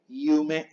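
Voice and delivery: "yume" as a slow iu-me; soft Japanese Y. Shown as a demonstration. Teacher reads the word for sueño, "yume" soft as iu-me. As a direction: begin "yume" with a soft i glide.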